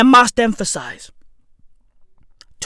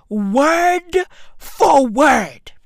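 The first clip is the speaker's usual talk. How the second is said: slow shouted emphasis; 3 semitones higher, much slower than usual. Acted. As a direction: hammer each word slowly. Emphatic outrage.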